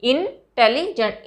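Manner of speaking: "इंटेलिजेंट" slowly, split as इन, टेलि, जेंट; syllable by syllable. Shown as a demonstration. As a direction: say "इंटेलिजेंट" in three separate beats. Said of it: "तीन जगह पे आप रुके".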